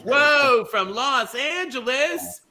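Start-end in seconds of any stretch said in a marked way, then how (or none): none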